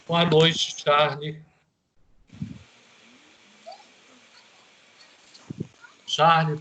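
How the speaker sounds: loud call into a silent line; calling out loudly. Real, not acted. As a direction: call his name up loud.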